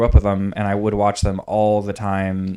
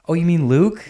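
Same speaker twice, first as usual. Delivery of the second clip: innocent question; 7 semitones higher than usual. Retold or imitated. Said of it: "And we're like".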